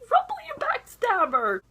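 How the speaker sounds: high-pitched mock accusation; indignant scolding. Viewer scolding Rumple over his betrayal, indignant. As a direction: call out a traitor in high-pitched outrage.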